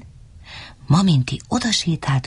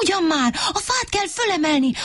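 growling; 10 semitones higher than usual. Acted. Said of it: "morgott Mikka Makka".

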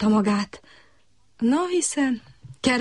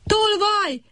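high shout of thief; the cricket cries out; cry of thief; 12 semitones higher than usual. A narrator's reading. Cricket cries after the thief, high shout. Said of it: "kiáltotta a kis tücsök".

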